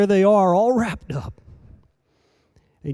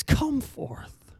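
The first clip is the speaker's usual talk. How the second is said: hushed, softer come forth; whispered call; 7 semitones higher, 7 dB quieter than usual. Acted. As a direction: breathe the call to sleepers. Soft call to the sleeping.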